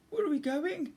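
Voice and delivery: high small timid voice; small scared bear cub. Acted. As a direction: speak as a frightened little cub.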